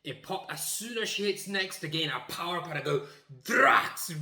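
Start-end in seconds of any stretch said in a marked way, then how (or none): 3.4-4.1 sudden, high-pitched blurted shout; one explosive, insanely loud word. Retold or imitated. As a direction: shout it out at full power. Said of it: "really loud".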